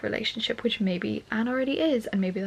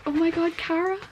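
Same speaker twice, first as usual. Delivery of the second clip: higher, louder thrilled cry; excited gasp of a name; 9 semitones higher, 4 dB louder than usual. Real, not acted. Finding the note from Cara, thrilled cry.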